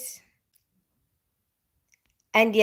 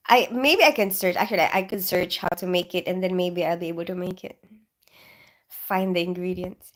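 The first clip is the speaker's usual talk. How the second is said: ordinary delivery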